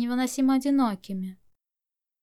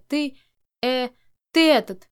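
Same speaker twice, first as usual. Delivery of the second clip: halting, stumbling insult with a louder final burst; lost for words; 3 semitones higher, 6 dB louder than usual. A narrator's reading. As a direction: grope for an insult, pausing. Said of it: "не зная, что ещё сказать". (запинаясь, не находя слов)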